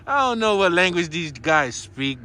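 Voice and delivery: ordinary talk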